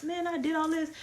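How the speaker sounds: high, mock-weary complaint; tired whine. Tired woman whining to herself about sitting down. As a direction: whine like you've earned the rest.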